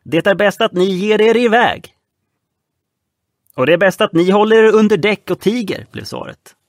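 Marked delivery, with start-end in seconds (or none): 0.0-1.9 high, loud warning; shouting warning. Acted. Frightened man on the yacht warning off the boys. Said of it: "ropade mannen på jakten". 3.5-5.9 loud, high, scornful answer; defiant retort. Acted. Boy telling the man on the yacht to stay below.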